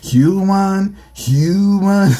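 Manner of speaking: "human" drawn out high, twice; exaggerated repetition. Friends trade the word back and forth, exaggerated high "human".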